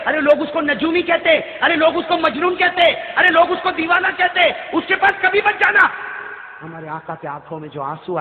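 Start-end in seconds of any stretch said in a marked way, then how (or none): 0.1-5.9 high, insistent preached warning; urgent warning. Acted. Old woman warning her son away from the sorcerer, urgent. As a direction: warn with rising urgency.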